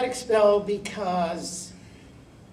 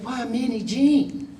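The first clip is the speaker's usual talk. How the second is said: higher, louder mock Southern accent; 7 semitones higher, 7 dB louder than usual. Vice principal explaining herself to Minnie Jean, imitated Southern accent. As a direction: put on a Southern lady's lilt. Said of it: "Southern accent".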